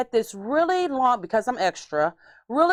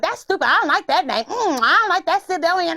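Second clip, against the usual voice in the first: higher, put-on mom complaining; mom's voice; 7 semitones higher, 3 dB louder than usual. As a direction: mimic mom ranting on a tangent. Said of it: "That's just how she sounds".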